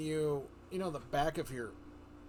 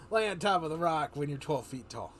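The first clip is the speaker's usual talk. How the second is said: ordinary talk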